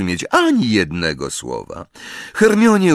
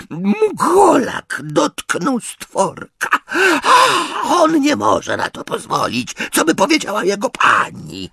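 indignant house-elf. A narrator's reading. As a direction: squeak out the outrage. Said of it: "wyraźnie oburzony".

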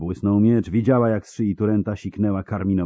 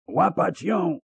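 high-pitched roar of command; commander's high, urgent yell; roared order; 11 semitones higher, slower than usual. A narrator's reading. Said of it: "ryknął Szkelen".

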